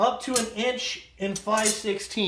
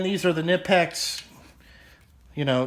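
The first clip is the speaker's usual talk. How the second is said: ordinary delivery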